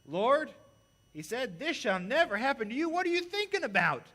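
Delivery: loud, high, pleading protest; hammed-up panicked friend yelling; frantic rebuke. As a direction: Peter shouting at Jesus in alarm. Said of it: "began to rebuke Him".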